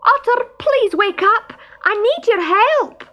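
high, loud call for help; loud shout. A narrator's reading. Stoat calls down to sleeping Otter, shouting loudly for help. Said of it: "Barney shouted loudly".

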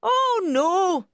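high, loud housekeeper character voice; helpful housekeeper, reassuring. A narrator's reading. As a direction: pipe up loudly as the helpful housekeeper. Housekeeper answers the narrator.

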